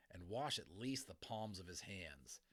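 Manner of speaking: usual speaking voice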